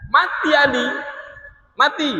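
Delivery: "Mati" thrown up high and sharp; dramatic emphasis. Acted. Preacher telling the stabbing to listeners, sharp and high. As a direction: land the death like a shock.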